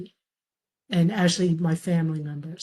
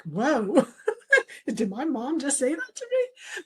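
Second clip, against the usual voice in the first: a startled laugh, voice jumping up; disbelieving whoa, laughing it off; surprised and delighted; laughing surprise; 7 semitones higher than usual. Retold or imitated. Stunned by mom's praise.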